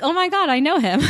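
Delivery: high, excited recognition; surprised recognition. Retold or imitated. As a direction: blurt it out in surprise. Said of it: "so I was like".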